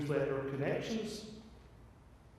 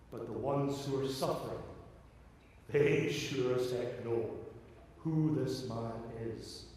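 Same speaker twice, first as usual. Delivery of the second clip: quiet and intimate; 4 semitones lower, 4 dB quieter than usual. Acted. Quiet emphatic close.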